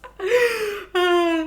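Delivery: tawa tinggi yang lepas; tawa geli. Real, not acted. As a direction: tertawa lepas karena lucu. Laughs at the book.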